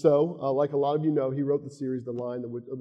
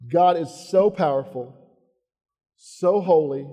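louder, urgent sermon build; preaching at full force; 5 dB louder than usual. Acted. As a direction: press the congregation with each line. Closing exhortation.

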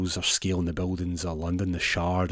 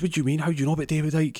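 surprised; 8 semitones higher, much faster than usual. Retold or imitated. Elf reacts in alarm.

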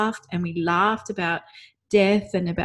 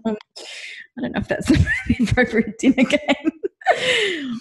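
slow, higher, laughing through the words; gently decline, amused; mother laughing at daughter's dinner game idea; laughing reply; 8 semitones higher, slower than usual. Retold or imitated. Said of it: "I was like".

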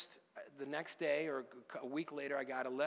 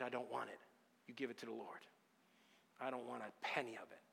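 soft, low, unhurried retelling; quiet release; 4 semitones lower, 7 dB quieter, faster than usual. Retold or imitated. Contractor forgives client's debt, quiet release. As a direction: let the debt go gently, with pauses.

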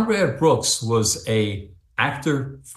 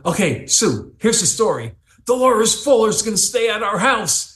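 excited phone call; 10 semitones higher than usual. Retold or imitated. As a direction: blurt the big news to your wife. Excitedly phoning his wife Sue.

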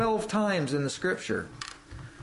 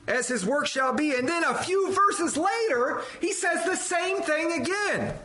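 lifted pulpit reading voice; solemn scripture reading; 7 semitones higher than usual. Acted. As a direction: read it like proclaiming scripture. Preacher reads Revelation aloud.

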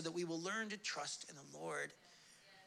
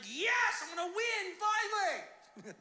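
high, drawn-out mock elation; triumphant; 12 semitones higher, 4 dB louder, slower than usual. Moses thrilled to win at last, exultant. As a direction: crow over a long-awaited win.

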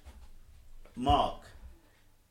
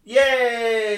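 loud, high triumphant yes; excited cheer; 11 semitones higher, 14 dB louder than usual. Real, not acted. Quizmaster hailing the equalising answer, loud cheer. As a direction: shout it like a goal celebration.

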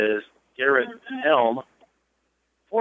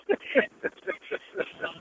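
breathy laugh that hurts; pained laugh; 9 dB quieter than usual. Real, not acted. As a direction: laugh through rib pain. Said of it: "And it hurts to laugh".

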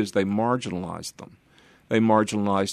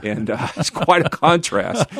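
amused, laughter spilling into the words; laughing; 5 semitones higher, 6 dB louder than usual. Real, not acted. To the host, amused by the accent mismatch, laughing. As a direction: laugh it off, louder.

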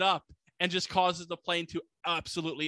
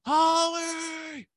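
high, drawn-out hammed-up yell; mock scream; 11 semitones higher, 3 dB louder than usual. Bruce covered in blood calling for his wife, mock scream. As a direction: holler the name in an exaggerated high cry. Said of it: "just screaming for Holly".

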